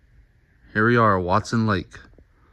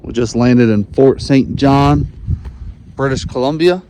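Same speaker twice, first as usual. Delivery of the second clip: raised voice over engine noise; shouting in propeller plane. Real, not acted. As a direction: call it out over the engine roar.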